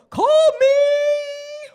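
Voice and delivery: high shouted call, hammed up; mock shout. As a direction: holler it like a playful parent. Mock parent shouting the name.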